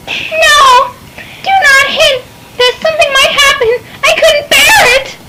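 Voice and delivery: high, upset crying out; tearful sister. Acted. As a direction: plead through tears. Sister dreads the parting.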